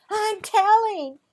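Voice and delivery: high, loud, slow tattletale whine; snapped threat. A narrator's reading. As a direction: drag it out like a kid threatening to tell. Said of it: "Lindsay snapped".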